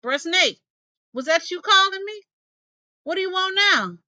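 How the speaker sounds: calling down to the snake, a little fed up. Acted. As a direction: call down into the pit, impatient. Possum calling into the hole.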